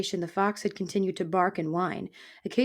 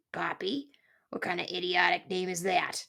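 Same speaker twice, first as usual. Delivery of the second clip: husky, softer grumpy disbelief; scornful porcupine; 3 dB quieter than usual. Acted. Porcupine mocking the mouse's name, husky scorn. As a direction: scoff under your breath like an old grouch.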